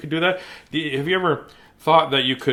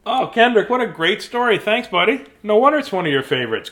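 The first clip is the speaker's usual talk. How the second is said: higher, louder gushing thanks; effusive praise; 8 semitones higher, 5 dB louder than usual. Pretend. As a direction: gush over a buddy's story with lively swoops.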